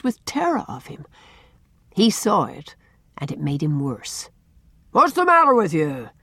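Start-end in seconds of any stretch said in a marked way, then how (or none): 4.9-6.1 big booming irritable demand; loud, impatient boom. A narrator's reading. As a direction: boom it out, louder and higher. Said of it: "he boomed".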